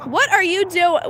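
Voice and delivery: ordinary talk, nothing marked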